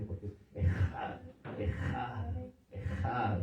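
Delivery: Ejad repeated with a rough rasped jota until the throat feels dry. Shown as a demonstration. Pastor joking the correct Ejad makes you thirsty, rasping. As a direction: scrape the J of Ejad hard each time. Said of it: "de la manera correcta, le va a dar sed".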